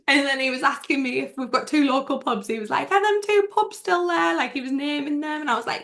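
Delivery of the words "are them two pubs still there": retold eager question, pitched much higher. Singer asks about two local pubs, eager questioning. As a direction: imitate him asking eagerly, voice lifted.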